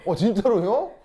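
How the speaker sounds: loud, high gasp of disbelief; astonished. Real, not acted. Teacher hears a second student confirm it, astonished. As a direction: react as if you can't believe it.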